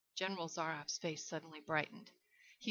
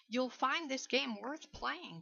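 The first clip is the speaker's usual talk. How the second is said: slow and higher, relishing it; enthusiastic; 6 semitones higher, slower than usual. A narrator's reading. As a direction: savour the promise of the game. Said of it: "the general said enthusiastically".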